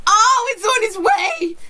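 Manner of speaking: high, loud, teary squeal of joy; ecstatic shriek. Real, not acted. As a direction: squeal it like you can't contain yourself. Announcing Evil Within Two.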